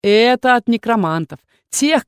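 plain reading voice

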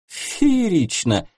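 high, slow, breathy marvelling; breathed out. Acted. Thrilled client sighing at the idea, slow and high. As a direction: let the word out on a long breath of delight. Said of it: "выдохнула клиентка".

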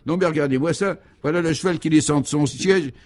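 high, astonished running commentary; highly excited. Acted. Guy shows his friends the horse leaving its seat, excitedly. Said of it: "fortement excité".

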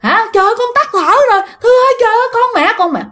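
very high, fast hysterical mother's cry; mother screaming for help. Retold or imitated. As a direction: beg for someone to save your child. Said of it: "mẹ của bạn á thì la làng". (mẹ la làng cầu cứu, giọng rất cao hoảng loạn)

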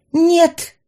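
high, loud cry of refusal; exclaimed protest. A narrator's reading. Heroine told to step back from the fire, exclaiming in protest. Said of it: "воскликнула я".

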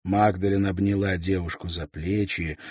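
usual speaking voice

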